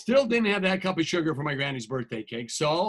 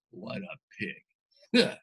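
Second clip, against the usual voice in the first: disgusted mutter; 12 semitones lower, 3 dB quieter, much slower than usual. Acted. Wolf's indignant remark.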